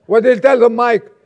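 loud, high preaching call. Real, not acted. Preacher demands the answer from a listener, calling out loudly. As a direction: punch it out to rouse the congregation.

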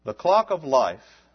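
slow, measured reciting of a poem; solemn sermon poem; poem recitation. Acted.

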